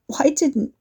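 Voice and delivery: hoarse croak, throat burning. A narrator's reading. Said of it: "Katie croaked".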